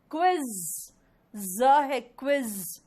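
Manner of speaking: "क्विज" ending in long buzzed z; "ज" and "क्विज" modelled with drawn-out z; correct quiz pronunciation. Shown as a demonstration. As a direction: hold the final z of "क्विज", loud. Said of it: "क्विज नहीं होता".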